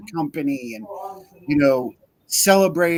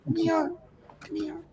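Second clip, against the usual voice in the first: high, soft pet call; coaxing a dog; 11 semitones higher, 6 dB quieter than usual. Real, not acted. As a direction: coax the dog over, high-pitched.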